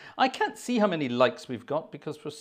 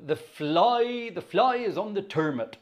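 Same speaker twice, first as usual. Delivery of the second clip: put-on rural Wiltshire accent, pitched up; 5 semitones higher, 3 dB louder than usual. Shown as a demonstration. Organist reading out a county song title, Wiltshire accent. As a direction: read it higher, in broad Wiltshire dialect, "turnip" as "turmut". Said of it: "about as good as my Wiltshire accent gets".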